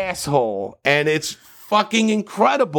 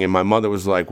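ordinary talk, nothing marked

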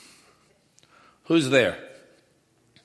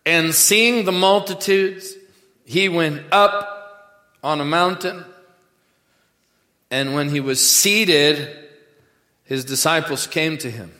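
slow, loud, raised pulpit reading; scripture reading; 6 semitones higher, 8 dB louder, slower than usual. Real, not acted. Preacher reading Matthew to congregation, slow loud proclamation. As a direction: proclaim the verse slowly, phrase by phrase.

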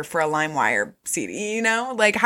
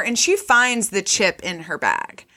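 usual speaking voice

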